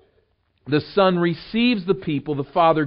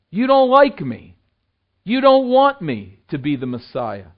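Jesus rebuking; 3 semitones higher, 7 dB louder than usual. Retold or imitated. Preacher voices Jesus rebuking.

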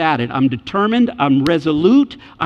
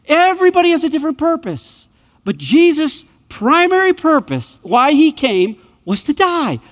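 higher and louder, driving the point home; rising preaching emphasis; 10 semitones higher, 4 dB louder than usual. Real, not acted. Preacher telling the church Jesus came to die, building emphasis. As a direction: lift it to a climax.

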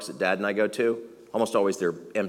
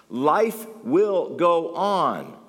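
slow emphatic refrain; 5 semitones higher, much slower than usual. Real, not acted. Sermon refrain, slowed.

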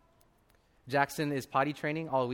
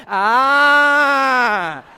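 long, high, very loud mock scream; hammed-up toddler tantrum cry, held out; dramatic wail; 11 semitones higher, 17 dB louder than usual. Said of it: "has to be completely dramatic".

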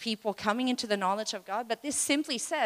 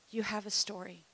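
quieter, you falling gently; hushed you have a story after the story; soft and intimate; 7 dB quieter than usual. Shown as a demonstration. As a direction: say it close, almost confiding. Softly after the blind man story.

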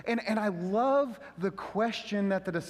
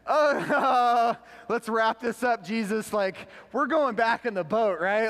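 nervous laughing plea to turn back; panicky laugh; 4 semitones higher, 6 dB louder, slower than usual. Pretend. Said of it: "I'd be like".

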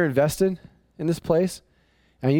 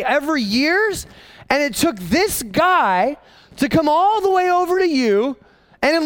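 incredulous; 9 semitones higher than usual. Retold or imitated. Exasperated at the idle people.

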